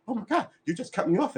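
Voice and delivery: high mock-offended complaint; offended group member. Group member upset at being cut off, pitched high. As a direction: imitate someone miffed at being interrupted. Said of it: "people were like".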